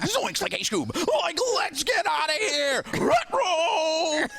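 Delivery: high, panicky Shaggy yelp into a Scooby rut roll. Retold or imitated. [name] reacting to Frank, imitating Shaggy and Scooby in a high panic. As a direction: freak out like Shaggy spotting the villain. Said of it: "when Shaggy and Scooby see the bad guy".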